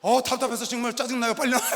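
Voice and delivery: fed up, calling him out; mock impatience. Wife calling her husband to hurry, loud mock impatience.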